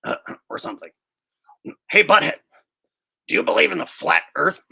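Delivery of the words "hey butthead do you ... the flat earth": louder cartoon teen voice; Beavis impression. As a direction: play a dim cartoon teenager, fast. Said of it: "best Beavis impression".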